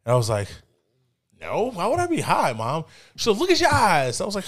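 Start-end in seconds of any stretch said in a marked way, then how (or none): 1.4-2.9 put-on high-pitched protesting teen; defensive denial. Son answers mom's question about being high, defensive. 3.4-4.2 high, loud scolding; accusing mom. Retold or imitated. Mom confronts her high son, sharply accusing.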